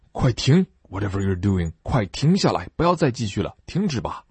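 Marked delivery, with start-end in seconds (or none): none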